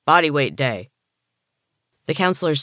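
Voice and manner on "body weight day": shouted order. Acted. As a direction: bark it like a drill sergeant. Counselor shouting.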